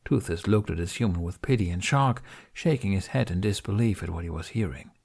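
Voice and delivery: plain reading voice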